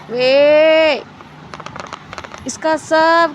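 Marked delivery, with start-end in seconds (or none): 0.1-1.0 loud drawn-out "veee"; long shouted attention-getter; loud call. Real, not acted. Calling out to the child.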